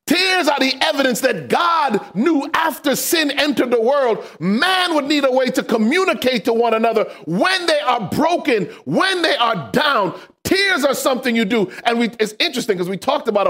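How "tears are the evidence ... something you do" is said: full-force preaching. Acted. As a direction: preach it out with rising fervor.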